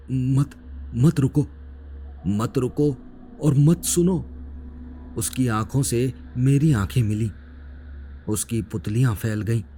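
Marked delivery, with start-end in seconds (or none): 0.1-4.2 halting, higher-pitched plea; repeated desperate warning. A narrator's reading. Wounded attacker to narrator, desperate repeated warning. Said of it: "एक ही शब्द वो बार-बार दोहरा रहा था".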